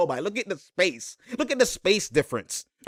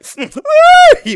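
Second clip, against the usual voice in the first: high shrieking laugh burst; screaming with laughter; howling laugh; 16 dB louder than usual. Real, not acted. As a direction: let out a loud high cackle. Reacting to highlight, howling laugh.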